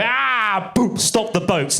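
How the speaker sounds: high, exaggerated cry into a chant. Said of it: "Sometimes I go a little bit too hard".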